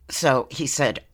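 normal talking voice

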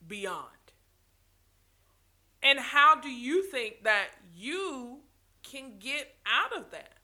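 ordinary talk, nothing marked

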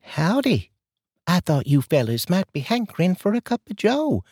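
high, folksy Southern neighbor voice; a chatty Southern lady offering coffee; sweet southern drawl. Acted. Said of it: "that sweet southern drawl".

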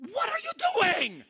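very high, loud outraged shout; coach's angry yell. Retold or imitated. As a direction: scream in disbelief at the player. Coach furious after the shot.

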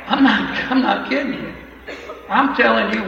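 laughing loud insistence; laughing, I'm not kidding. Real, not acted.

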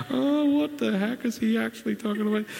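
high, incredulous put-on voice; a preacher voicing introverts doubting his point; mock introvert. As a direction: play a baffled introvert scoffing. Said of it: "As all the introverts go".

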